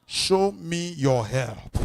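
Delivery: loud, slow cry to the Lord; loud prayer. Shown as a demonstration. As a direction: shout the prayer slowly. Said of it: "Shout it loud and clear".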